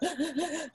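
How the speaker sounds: sharp sobbing gasp, high and quiet; choking sob gasp. Retold or imitated. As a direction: gasp for air mid-sob. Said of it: "She sounded like she was choking".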